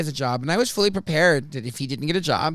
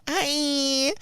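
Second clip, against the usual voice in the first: much higher squeaky LA hi; Kardashian-style hi; 12 semitones higher than usual. Retold or imitated. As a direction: chirp it like a reality star. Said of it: "It's very Kardashian".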